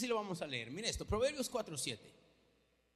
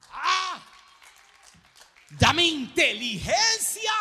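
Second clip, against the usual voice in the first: high, loud shouted cry; preacher's shout; 7 semitones higher, 11 dB louder, much slower than usual. Acted. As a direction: shout it to the rafters. Shouted climax of the prayer.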